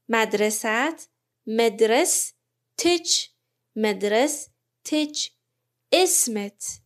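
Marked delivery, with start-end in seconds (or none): 0.1-5.3 مدرس تچ drawn out slowly, "madrasatech"; slow Arabic demo. Shown as a demonstration. Teacher modelling مدرس تچ as "madrasatech" for students, slow. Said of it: "کافیه ه رو تبدیل به ت کنیم".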